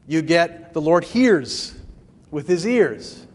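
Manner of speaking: normal talking voice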